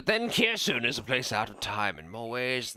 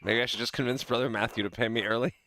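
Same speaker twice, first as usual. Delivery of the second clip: ordinary talk, nothing marked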